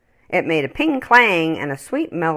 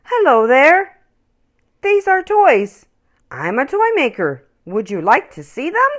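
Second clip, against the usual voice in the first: higher kind little toymaker; gentle toymaker Alfie; 9 semitones higher, 6 dB louder than usual. Acted. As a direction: greet the child kindly. Alfie answers the boy.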